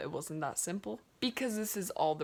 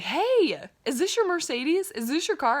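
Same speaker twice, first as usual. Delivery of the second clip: calling out; 8 semitones higher, 7 dB louder than usual. Retold or imitated. Calling to a stranger.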